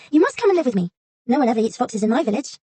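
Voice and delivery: put-on high girl voice; little girl. A narrator's reading. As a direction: answer as a young girl.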